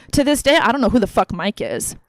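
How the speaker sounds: usual speaking voice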